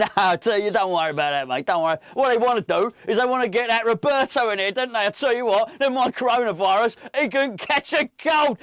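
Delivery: a loud, high, bantering fan in the stands; loud laddish banter; loud mock fan. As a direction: shout it across the terrace, laughing.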